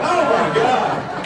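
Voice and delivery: very high, slow, loud drawn-out cry; shocked outburst. Retold or imitated. Son learns his birth year, horrified shriek. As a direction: stretch it out in horror. Learns he was born then.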